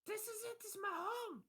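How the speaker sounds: very high, put-on kid voice; high-pitched small boy; little child. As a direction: announce it in a high child's squeal. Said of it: "he goes".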